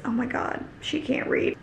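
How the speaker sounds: hushed, barely voiced mock horror; dawning realisation. Reader voices a character realising she can't read, hushed dismay. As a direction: let it sink in under your breath. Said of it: "And then he realizes".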